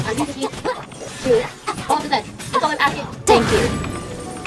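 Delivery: ordinary delivery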